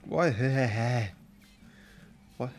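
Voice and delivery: usual speaking voice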